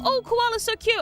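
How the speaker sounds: high, sing-song imitation of gushing tourists; lightly voiced doting fan; mock gushing. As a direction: mimic someone cooing over koalas. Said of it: "People go".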